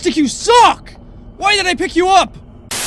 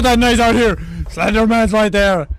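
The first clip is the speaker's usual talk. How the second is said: deep voice; 11 semitones lower than usual. Pretend. Low odd voice.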